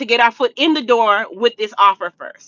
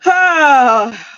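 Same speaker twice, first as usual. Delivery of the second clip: heavy sigh; 2 semitones higher, 5 dB louder than usual. Real, not acted. Reacting to lost house.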